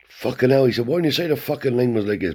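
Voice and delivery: exasperated uncle. Retold or imitated. Uncle Tony scolding nephew about the lane, exasperated high voice. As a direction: vent at the nephew.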